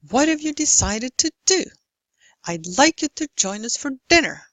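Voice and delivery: strong beats on "What", "decided", "do", "like", "join", "dinner"; regular stressed rhythm. Shown as a demonstration. Lecturer demonstrates clapped rhythm, "decided" and "dinner" on regular beats. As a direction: squeeze "have you" and "you to" between even beats on the stressed words. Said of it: "fall into a regular rhythm".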